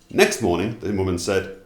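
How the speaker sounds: usual speaking voice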